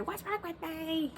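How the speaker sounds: lightly whiny self-question; mock despair. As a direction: moan it slowly as a joke. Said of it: "when I just wanna say".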